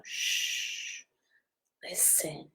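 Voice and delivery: very soft shush and hushed listen. Shown as a demonstration. Reader showing children how to shush, soft. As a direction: show them how to shush, barely there. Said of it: "Can you go".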